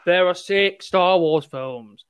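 slow, spelled-out retort; emphatic, measured correction; slow insistence. Retold or imitated. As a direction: say it word by word, as if to someone stubborn. Retorting slowly to stubborn arguer.

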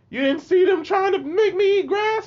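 high-pitched, loud mock wail; incredulous protest. Bullied kid appealing to his teacher, high incredulous wail. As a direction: protest in a squeaky, wailing voice.

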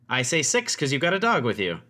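high, loud peppy chatter; peppy halfling. Pretend. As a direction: bubble over at the visitors. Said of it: "she's this very peppy little halfling".

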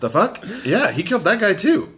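high, amused mock outrage; laughing disbelief. Real, not acted. Cohost laughing at the twist, high amused disbelief.

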